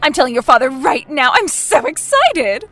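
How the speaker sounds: high, loud, tumbling questions; can't contain her joy; gushing excitement. Acted. Mother learning her daughter is dating, gushing excitedly. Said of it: "Mom, calm down".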